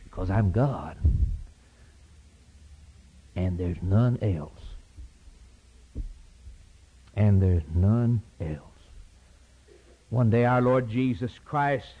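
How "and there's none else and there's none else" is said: slow, lower, weighted with long pauses; solemn repetition. Real, not acted. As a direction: let each word fall heavy. Solemn repeated declaration.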